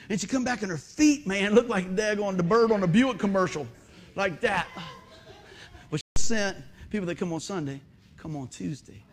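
ordinary talk

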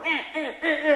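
high, repeated croaking car noise; imitated frog croak. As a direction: croak on and on comically. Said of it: "makes a noise like a frog".

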